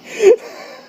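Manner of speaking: high squeaky laugh; can't hold back a high giggle. Real, not acted. Vlogger laughing at how silly he sounds. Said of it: "this sounds ridiculous in my own head".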